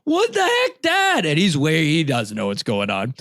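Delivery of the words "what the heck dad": high-pitched retold yell, calling across the creek; yelling for Dad. Soaked kid yelling to his distant dad, high-pitched retold yell. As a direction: shout up the creek for Dad after getting soaked. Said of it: "And I'm yelling".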